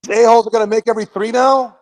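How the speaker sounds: fast, high, loud exasperated yell; yelling from the sidelines. Real, not acted. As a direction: rant at the TV in disbelief. Fan yelling at game.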